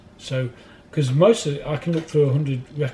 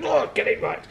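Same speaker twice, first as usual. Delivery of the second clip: high-pitched mock scolding; put-on taskmaster voice, pitched right up; barking taskmaster impression. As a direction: chide them like a hard-driving dance teacher. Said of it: "Kev was like a taskmaster".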